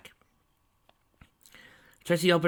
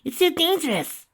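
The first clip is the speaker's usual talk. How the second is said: high arguing voice; 8 dB louder than usual. A narrator's reading. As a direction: argue her out of it, high. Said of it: "Ditsy argued".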